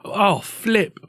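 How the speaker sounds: high, prim British "Oh, flip"; mild British exclamation; mock British accent. Cohost caps the British slang bit. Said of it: "British idiom".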